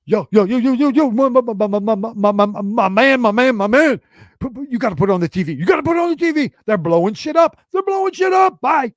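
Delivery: high, loud, frantic voicemail rush; panicked voice. Retold or imitated. Friend's panicked voicemail, frantic and shouting. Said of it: "panicked voice".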